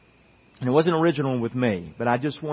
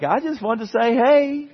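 much higher, sing-song innocent tone; innocent reply; 10 semitones higher, 3 dB louder than usual. Retold or imitated. Pastor answers the professor, higher innocent tone. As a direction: play it all innocent.

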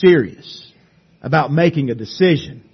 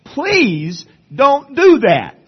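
high, slow pleading call; pleading cry; 8 semitones higher, 4 dB louder, much slower than usual. Retold or imitated. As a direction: beg him not to do it. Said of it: "he cried out".

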